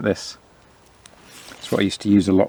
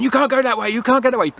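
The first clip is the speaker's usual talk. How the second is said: much higher mock yell, a touch louder; retold shouting; 12 semitones higher, 4 dB louder, faster than usual. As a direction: ham up her frantic calling after him. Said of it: "and she's still shouting at me".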